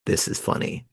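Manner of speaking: half-whispered private thought; inner thought, hushed. Retold or imitated. Amused at dad's funeral, thinking it quietly. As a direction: murmur it to yourself, almost unvoiced. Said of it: "And yet I'm like".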